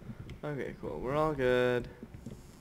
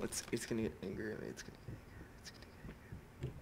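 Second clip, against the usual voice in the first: fast, hushed, frightened whispering; 6 semitones lower, 13 dB quieter, much faster than usual. Real, not acted. A player hiding from the monster, whispering in fear. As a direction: breathe the words so the monster can't hear.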